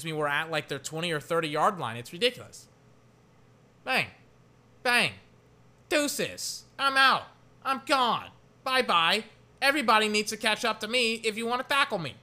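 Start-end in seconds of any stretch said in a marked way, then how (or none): 3.8-12.1 high, loud gloating taunt; cocky runner. Pretend. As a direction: brag like a runner breaking away. Voices the running back scoring.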